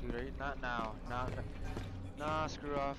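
loud frustrated yell. Real, not acted. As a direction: shout it at the screen in frustration. Yelling at the game.

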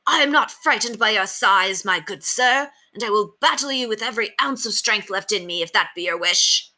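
high, formal knightly voice; defiant tiny knight. A narrator's reading. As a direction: declare battle with old-world gallantry. Knight's defiant speech in story.

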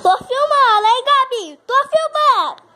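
loud call across the room. Real, not acted. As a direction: shout to be heard while filming. Calling out to Gabi.

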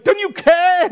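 put-on high, loud childlike whine-scream; wailing little kid. Disciples to Jesus like little kids, wailing. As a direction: cry it out like an upset child. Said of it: "Like little kids".

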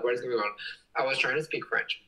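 usual speaking voice

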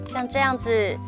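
ordinary talk, nothing marked

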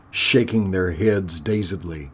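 ordinary delivery, nothing marked

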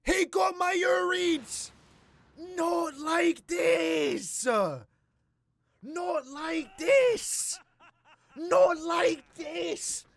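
very slow, high, loud hammed-up despair; anguished wail. Real, not acted. Watching his favourite lose, a hammed-up slow anguished wail. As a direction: wail it out like your hero just fell.

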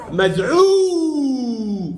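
مذعور with the عو vowel drawn out very long; stretched vowel. Shown as a demonstration. Teacher shows the pupil how he lengthened مذعور, very slow. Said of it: "طولت العين بالواو".

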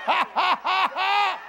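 high, deliberate ha-ha-ha; demonstrated happy laugh. Shown as a demonstration. Preacher acting so happy he just starts laughing, staged laugh. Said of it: "you just start laughing".

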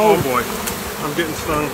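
sudden alarm, getting stung; real panic as bees sting. Real, not acted.

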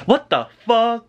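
high, theatrical disbelief; exasperated outburst. Pretend. Vlogger's one word on the mask plan, exasperated. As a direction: let it burst out high after a calm denial.

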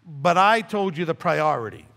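normal talking voice

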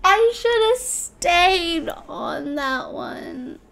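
high, very drawn-out wail; wailing regret. Real, not acted. Player lamenting a fall in the game, wailing regret.

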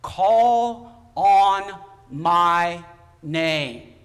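slow, loud, drawn-out call from beyond. Retold or imitated. Preacher retelling a heavenly voice, loud and slow. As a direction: boom it out like a voice from heaven. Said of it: "This voice said to me".

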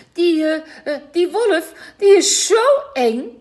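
put-on high, loud, slow tearful child; sobbing. A narrator's reading. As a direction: sob it out, scared. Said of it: "snikt Marieke".

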